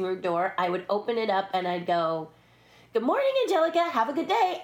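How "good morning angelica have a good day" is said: high, loud singsong hello; gleeful prank greeting. Retold or imitated. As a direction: call it cheerily before running away. Prank greeting shouted at star's door.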